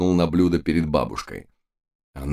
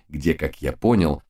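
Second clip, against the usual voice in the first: plain reading voice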